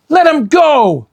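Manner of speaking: put-on high, loud girl's shout; shouting demand. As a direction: demand it fiercely. Annie attacking pirate.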